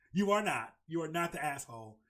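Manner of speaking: normal talking voice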